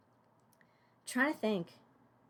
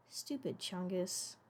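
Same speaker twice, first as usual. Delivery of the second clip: normal talking voice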